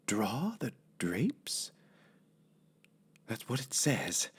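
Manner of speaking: soft, slow whispered puzzling; hushed unvoiced musing. A narrator's reading. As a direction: whisper it as if working it out alone. Amelia puzzling quietly to herself.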